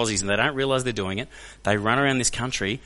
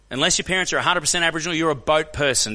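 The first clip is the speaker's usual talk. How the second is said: fast, higher-pitched insistence; rapid, heated restatement; brisk, emphatic verdict; 5 semitones higher than usual. Real, not acted. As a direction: fire it off quickly and higher. Repeats the verdict to audience.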